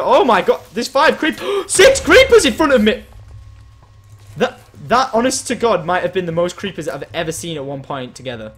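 loud, high panicked exclamation; shocked outburst. Real, not acted. Player faced with six creepers, shocked outburst. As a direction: burst out in alarm, gasping midway.

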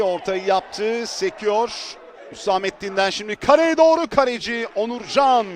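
ordinary delivery

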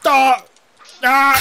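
loud sharp cry; startled yell. Real, not acted. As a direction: yelp in shock. Sudden startled yells.

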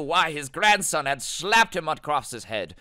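loud, slow hammed-up indignation; theatrically aghast, drawn out; mock outrage. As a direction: blare each word out in mock disbelief.

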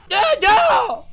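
high pained yell; frustrated shout. Real, not acted. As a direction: cry out in frustration. Dies in game.

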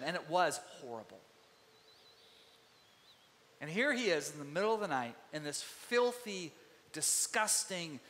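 ordinary talk

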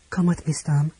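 ordinary delivery

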